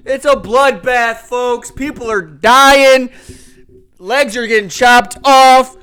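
high, loud, slow doom hype; dramatic hype. Acted. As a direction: oversell the carnage. Over-the-top recap hype.

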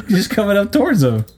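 stifled giggles. Real, not acted. Laughing while describing blob.

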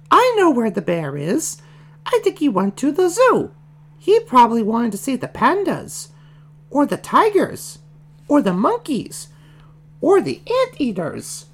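very high falsetto piggy voice; high-pitched character listing her guesses; bossy baby Piggy, confident. Acted. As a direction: squeak it out like a know-it-all little girl pig.

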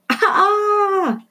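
very high piercing shriek; scream. Acted. Rita shrieks very high with something in her hair. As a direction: let out a terrified shriek. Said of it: "screamed Rita".

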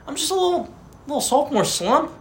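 usual speaking voice

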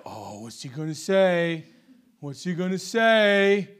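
slow, drawn-out mock anticipation from the crowd; eager crowd. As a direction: act out the crowd hanging on his words. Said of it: "everyone's just like".

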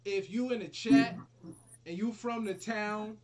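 loud, high hype call. Real, not acted. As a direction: shout it out to the chat. Calling out to the chat.

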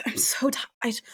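soft unvoiced whisper of "I'm so tired". Mimicking the sleepy date to friends in a whisper. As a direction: breathe it out like she's drained.

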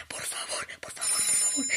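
almost silent whispered begging; faint whisper. Acted. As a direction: beg in a dying whisper. Faint pleading after call drops. (súplica casi muda)

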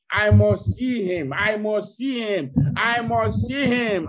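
loud, slow, high insistent cry; Mary's insistence. Acted. Mary at the tomb, loud insistent cry. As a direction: cry out each one, refusing to give up. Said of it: "Mary said".

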